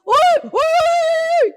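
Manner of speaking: the game ranger yells at the charging rhino to scare it off; ranger's shout. Retold or imitated. Ranger yelling at the rhino again.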